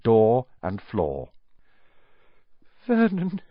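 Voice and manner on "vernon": high, quivering call. A narrator's reading. Wife frightened by letter, quivering voice. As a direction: say the name with a tremble. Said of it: "saying in a quivering voice".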